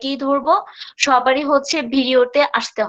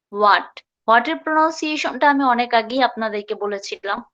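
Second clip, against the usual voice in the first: usual speaking voice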